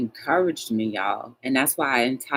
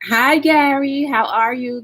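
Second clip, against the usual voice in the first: much higher, louder hello; high greeting; 11 semitones higher, 6 dB louder than usual. Real, not acted. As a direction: greet a friend joining the stream. Greets viewer joining live chat.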